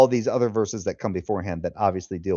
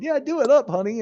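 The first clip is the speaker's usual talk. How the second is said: mock cheer; 13 semitones higher than usual. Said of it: "sitting there just going".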